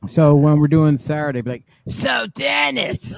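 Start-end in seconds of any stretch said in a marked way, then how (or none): 1.9-3.1 high shrill mom voice; hammed-up nosy mom, laughing; nagging Yonkers mom. Mocking interviewer voice, laughing.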